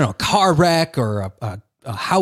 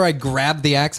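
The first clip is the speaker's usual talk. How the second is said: ordinary talk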